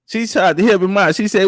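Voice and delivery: ordinary talk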